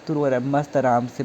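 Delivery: ordinary talk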